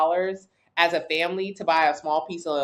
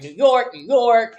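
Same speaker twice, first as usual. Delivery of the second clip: mocking imitation; 4 semitones higher, 7 dB louder, slower than usual. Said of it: "so she was like".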